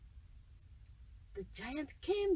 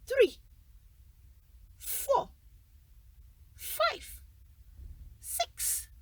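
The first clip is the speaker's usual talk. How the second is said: greedy giant counting gold, climbing higher; each number pitched higher and gleeful; 6 semitones higher, 4 dB louder, much slower than usual. Acted. Giant counting coins again.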